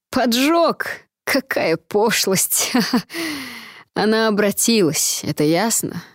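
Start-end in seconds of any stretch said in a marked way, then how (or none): 0.1-2.7 high scoff, then slow disdain; unhinged villain. Acted. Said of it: "истерически расхохотался". 2.8-3.6 high unhinged cackle; hysterical laugh. Acted. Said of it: "истерически расхохотался".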